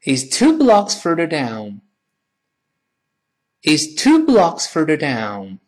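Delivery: slow, clear teacher reading; full-sentence model. Shown as a demonstration. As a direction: read the whole phrase slowly for repetition. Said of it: "完整的说一遍".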